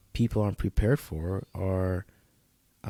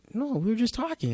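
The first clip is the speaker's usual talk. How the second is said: mock girlfriend; 13 semitones higher, 3 dB quieter than usual. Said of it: "she's like".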